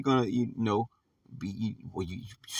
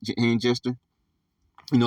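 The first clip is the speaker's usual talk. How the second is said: ordinary talk, nothing marked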